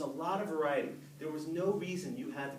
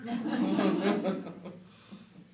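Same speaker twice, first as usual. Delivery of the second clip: hearty laugh; 4 semitones higher, 7 dB louder than usual. Real, not acted. Audience laugh.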